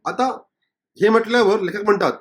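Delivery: normal talking voice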